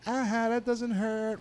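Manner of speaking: put-on high, slow sing-song kid taunt; mock taunting. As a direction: tease like a defiant child. Acting himself as taunting child.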